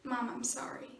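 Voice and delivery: soft, remorseful, falling away to almost nothing; subdued apology. Acted. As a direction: back down quietly after the outburst. Backs down, apologises to mother.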